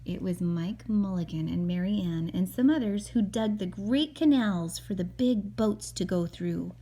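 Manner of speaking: gentle storybook narration read aloud; calm read-aloud for a little boy; reading to a child. Acted. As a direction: read it like a bedtime picture book. Mother reads picture book aloud.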